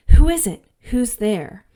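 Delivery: high, loud startled who is it; startled call. Retold or imitated. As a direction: call out alarmed into the dark room. Janet startled in bed.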